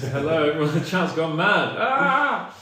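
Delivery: ordinary delivery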